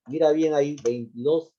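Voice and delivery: ordinary delivery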